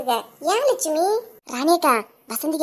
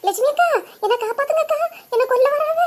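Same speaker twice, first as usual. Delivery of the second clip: pleading; 8 semitones higher than usual. Acted. Wife begging neighbour Lakshmi for protection, tearful pleading.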